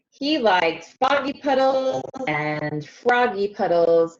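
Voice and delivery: put-on lively storybook reading for young kids; read-aloud narration. A narrator's reading. Teacher reading a picture book to her class, animated read-aloud. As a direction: read it like circle time, bright and inviting.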